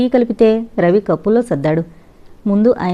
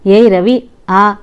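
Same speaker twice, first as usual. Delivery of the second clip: sudden loud startled call; surprised call; 6 dB louder, slower than usual. A narrator's reading. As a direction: blurt out a friend's name in shock. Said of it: "Kishore ఆశ్చర్యంతో అనబోతుంటే".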